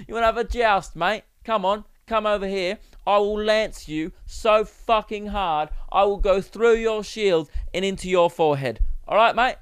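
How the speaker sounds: British geezer. Acted. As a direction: pick a fight as a hammed-up British geezer. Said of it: "that's a British way to pick a fight".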